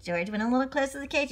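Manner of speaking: usual speaking voice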